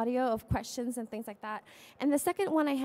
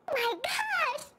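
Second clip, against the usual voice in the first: put-on Southern California valley-girl exclamation, pitched much higher; Southern California accent; slower than usual. Shown as a demonstration. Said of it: "my accent is very Southern California".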